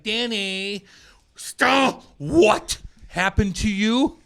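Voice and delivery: high, loud and slow, a mother in alarm; alarmed mom. Retold or imitated. Mom crying out as her son tells what happened. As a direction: call out the son's name, then ask slowly and loudly. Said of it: "My mom's like".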